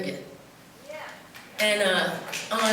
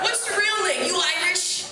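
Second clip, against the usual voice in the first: much higher, brusque grilling; blunt local; 8 semitones higher than usual. Retold or imitated. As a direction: fire the questions bluntly. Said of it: "there's none of this like".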